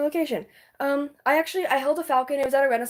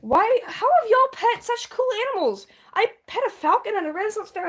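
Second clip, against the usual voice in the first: high excited exclaim; 8 semitones higher than usual. Real, not acted. Envious exclamation.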